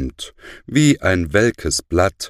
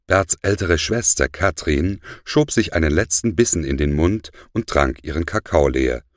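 ordinary delivery

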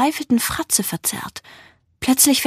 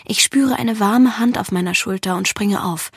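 plain reading voice

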